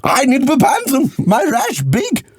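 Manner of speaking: a tipsy Dutchman insisting on his cream; Louis van Gaal. Acted. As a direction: Dutch accent, stubborn and tipsy. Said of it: "You sound Louis van Gaal".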